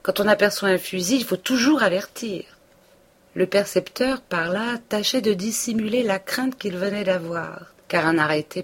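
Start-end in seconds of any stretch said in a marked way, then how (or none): 0.0-2.4 raised, higher scolding voice; Binet warning. A narrator's reading. Binet the tax collector warning Emma, raised and scolding. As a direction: lecture her as a nervous hunter.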